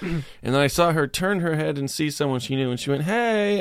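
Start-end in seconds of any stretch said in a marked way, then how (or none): 3.0-3.6 high, bright call of hey; excited greeting. Retold or imitated. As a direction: call out to a friend you just spotted. Retold friendly greeting.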